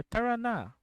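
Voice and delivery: high-pitched mimicry of non-native Tagalog accent on "Tarana"; weird accent. Streamer offering another take on the character's line, weird accent. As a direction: put on an odd accent for "Tarana", English r, falling end. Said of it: "this weird accent that she does".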